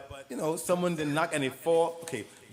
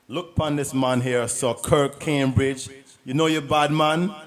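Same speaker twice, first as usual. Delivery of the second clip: put-on West Indian accent; 11 dB louder than usual. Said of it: "It's clearly West Indian".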